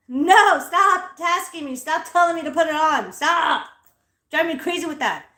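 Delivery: high, loud, fed-up protest; loud exasperated. Retold or imitated. Daughter yelling at her dad to stop nagging about masks, loud and fed up. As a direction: push back hard, sick of being told.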